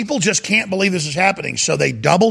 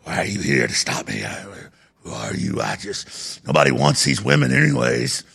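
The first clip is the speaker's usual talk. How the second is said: much lower, put-on rural hillbilly voice; 7 semitones lower than usual. Host imitating a man taking kids to Pennsylvania, low put-on hillbilly voice. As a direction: sink way down and play the Pennsylvania hillbilly. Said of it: "I'm not trying to talk like a hillbilly".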